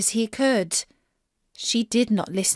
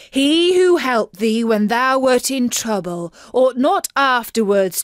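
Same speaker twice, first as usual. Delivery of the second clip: angry king; 5 dB louder than usual. Acted. King angrily rebukes his daughter for despising the frog. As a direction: scold her for her ingratitude. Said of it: "the king grew angry and said".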